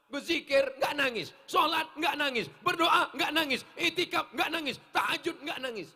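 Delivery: loud, high, rhythmic reproach; scolding list. Real, not acted. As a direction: rattle off each failing at the crowd.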